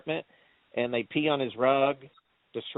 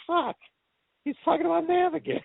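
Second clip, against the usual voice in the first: high-pitched fed-up groan of words; comic high exasperated complaint; mock exasperation. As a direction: act out weary disbelief, pitched way up. Acting the Dude's exasperated reaction.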